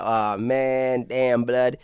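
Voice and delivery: lightly voiced New York street accent; a touch of Brooklyn Yankee accent. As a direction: drop into a casual New York drawl. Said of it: "that New York Yankee accent".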